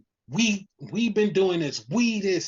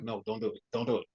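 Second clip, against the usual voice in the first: low, soft urgent aside; hushed warning; 8 semitones lower, 8 dB quieter, much faster than usual. Retold or imitated. Paul Heyman quietly warns against acknowledging Roman, hushed pleading. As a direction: imitate an advisor warning under his breath.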